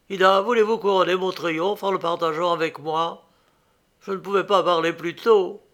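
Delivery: elated proposal. A narrator's reading. Inventor asks his sweetheart to share his triumph, elated.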